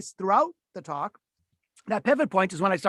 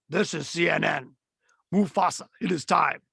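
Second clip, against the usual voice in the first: lightly voiced booming movie-trailer baritone; James Earl Jones voice; 2 semitones lower than usual. Shown as a demonstration. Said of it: "that James Earl Jones, that big voice".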